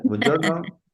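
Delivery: showy mock Italian lilt; exaggerated Italian accent. Shown as a demonstration. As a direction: overdo an Italian greeting. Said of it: "No, no hago eso".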